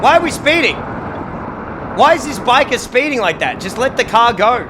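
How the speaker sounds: loud, high-pitched exclamation; alarmed outcry. Real, not acted. Reacting to a speeding biker, loud and high-pitched. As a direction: call out in loud, high disbelief.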